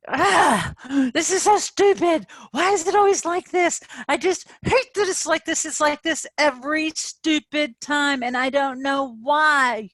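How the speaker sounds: high, loud, fed-up ranting; acted frustration. Coach acting out her own frustrated rant, high and loud. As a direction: act out a frustrated tantrum. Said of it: "I'm acting, I'm acting".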